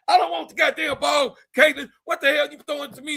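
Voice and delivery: high, mock yelling cop; hammed-up irritated cop; angry police. Police officer yells at Caitlin about the ball, mock anger. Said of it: "Police said".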